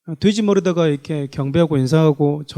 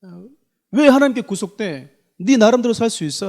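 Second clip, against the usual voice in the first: Satan's taunt; 4 semitones higher, 3 dB louder than usual. Retold or imitated. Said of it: "사단은 그래요".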